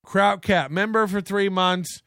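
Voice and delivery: ordinary talk, nothing marked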